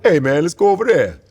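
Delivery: lightly voiced easygoing ancient man; Eddie Murphy voice. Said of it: "That sounded like more like Eddie Murphy".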